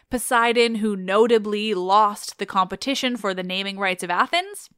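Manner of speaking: ordinary talk, nothing marked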